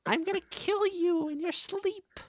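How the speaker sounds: squeaky little villain making threats; very high pipsqueak menace; tiny Master. Acted. Host voicing the little pet Master, squeaky threat. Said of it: "He would keep saying".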